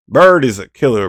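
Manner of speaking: normal talking voice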